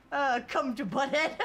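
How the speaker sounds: lightly voiced Butthead, laughing through it; a loose Butthead impression. Streamer reading a chat message as Butthead. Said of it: "in my best Butthead voice".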